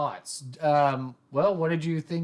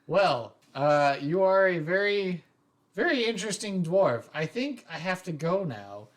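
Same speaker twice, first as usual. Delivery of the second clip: higher, polite, awkward excuse; shy new student; 4 semitones higher than usual. Acted. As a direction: back away politely like a shy newcomer. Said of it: "I'm, I'm shy".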